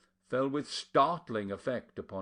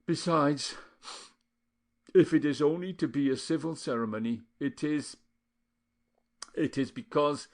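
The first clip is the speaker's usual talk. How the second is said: broken; 5 semitones higher, 4 dB louder than usual. A narrator's reading. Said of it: "said brokenly".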